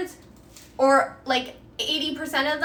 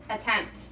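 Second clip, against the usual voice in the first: "Attempt" set apart, slow and lower; stress on attempt; 4 semitones lower, 4 dB quieter than usual. Shown as a demonstration. Streamer warning chat the recipe may fail, stress on attempt. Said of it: "is the key word here".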